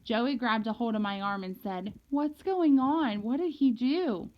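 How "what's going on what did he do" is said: higher, louder, drawn-out worried questions; nervous child demanding to know; jumpy. A narrator's reading. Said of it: "starting to get jumpy".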